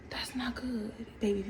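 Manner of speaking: soft unvoiced whisper, tender. Whispering to a crying viewer to comfort them. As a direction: breathe it out like soothing a child.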